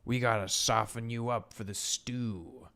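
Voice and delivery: slow, drawn-out chef taunt; chef villain. Acted. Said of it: "he would go".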